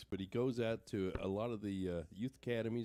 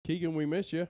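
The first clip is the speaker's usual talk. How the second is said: louder, higher, through laughter; laughing; 6 semitones higher, 6 dB louder than usual. Real, not acted. Teasing a message to absent Keegan, laughing. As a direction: call it out while laughing.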